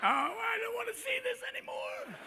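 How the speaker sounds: very high, thin mock wail; horrified conscience. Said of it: "it's your conscience going".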